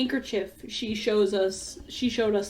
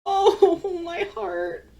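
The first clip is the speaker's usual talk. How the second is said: laughing tearfully; 7 semitones higher, much slower than usual. Real, not acted. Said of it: "laugh cry".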